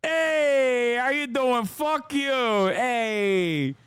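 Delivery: high, drawn-out New Jersey Italian wiseguy. Acted. Streamer mock-protesting anti-Italian hate speech, hammed-up New Jersey Italian. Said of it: "That's pure New Jersey. ... the Italian slurs".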